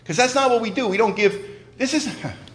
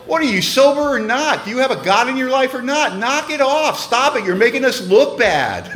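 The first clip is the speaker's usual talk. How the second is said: raised, blunt no-nonsense sponsor voice; 7 semitones higher than usual. Retold or imitated. Sponsor setting Don straight, blunt and raised. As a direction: hit him like a tough-love sponsor. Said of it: "He would just go".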